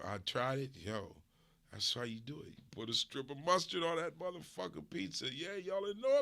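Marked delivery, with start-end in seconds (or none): none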